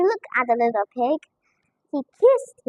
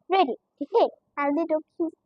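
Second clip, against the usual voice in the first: plain reading voice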